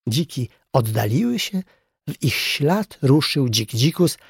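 ordinary delivery

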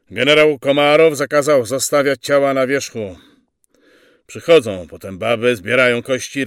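loud, forceful lecturing; sergeant's harangue. Acted. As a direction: silence the men and lay down orders. Sergeant lectures his men.

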